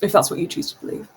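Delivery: quick whispered muttering; fast whispered resignation; muttered aside. Acted. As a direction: mutter it under your breath. Character speaks under breath.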